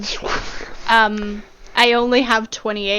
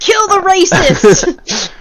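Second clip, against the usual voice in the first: higher, slow, drawn-out shout; mock battle cry; 7 semitones higher, 3 dB louder, slower than usual. Player rallying against a xenophobic character, mock battle cry. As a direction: yell it like a joking rallying cry.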